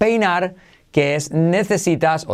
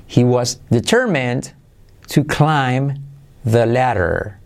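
frase modelo despacio; slower than usual. Shown as a demonstration. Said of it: "Fíjate la transcripción".

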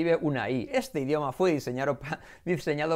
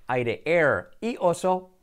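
ordinary delivery

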